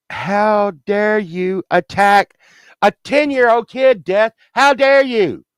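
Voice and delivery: high-pitched, drawn-out indignation; mad at Death. Retold or imitated. Man defending his inner ten-year-old from Death, indignant and high. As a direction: scold Death in rising acted outrage. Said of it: "And I got real mad".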